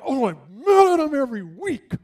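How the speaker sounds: high, loud mock fury; imitated mad widow. Widow telling pastor she is mad every week, hammed-up anger. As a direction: act out her exasperated outburst. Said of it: "she's mad".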